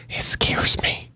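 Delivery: slow, voiceless whisper, like a secret. Real, not acted. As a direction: hush it so nobody overhears. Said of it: "I don't wanna talk too loud".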